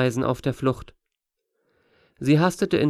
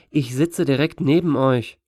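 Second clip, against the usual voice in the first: ordinary delivery, nothing marked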